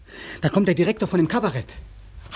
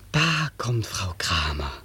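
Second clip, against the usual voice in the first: a slow hushed whisper; 11 semitones lower, 5 dB quieter, slower than usual. Acted. Whispering to his inner voice as Frau Kramer comes. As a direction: breathe it unvoiced and slow.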